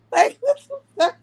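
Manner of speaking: high squealing laugh breaking into the words; real laughing. Real, not acted. Recalling the pigeon attack, laughing high in disbelief.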